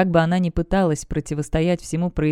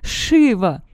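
calling out; 3 semitones higher, 4 dB louder than usual. A narrator's reading. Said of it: "продолжала кричать Демонесса".

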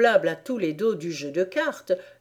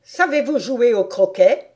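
loud, higher imperious question; terrible queen; 4 semitones higher, 8 dB louder than usual. A narrator's reading. Queen asks Alice about croquet, loud and imperious. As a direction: question her like a fearsome queen. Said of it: "demanda ensuite à Alice la terrible reine".

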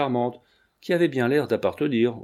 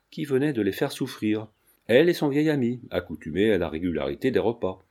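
plain reading voice

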